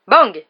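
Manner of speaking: sudden loud imitated bang. A narrator's reading. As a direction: burst out with a booming «Bang!». Narrator sounds out the detonation.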